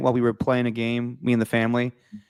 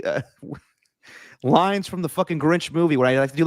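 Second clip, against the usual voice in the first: ordinary delivery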